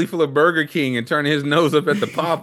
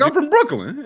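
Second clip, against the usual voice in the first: put-on Brooklyn accent; 14 semitones higher than usual. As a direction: sound like a guy from Brooklyn, pitched way up. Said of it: "He's like".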